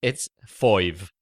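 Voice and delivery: "foive" with an oy vowel; five with an oy. Shown as a demonstration. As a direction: round the i of "foive" into oy. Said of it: "with an I sound".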